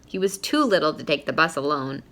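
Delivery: ordinary delivery, nothing marked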